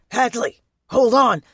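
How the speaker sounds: loud, urgent call; urgent shout. Acted. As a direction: yell it across the gap. John shouts to fallen Hadley.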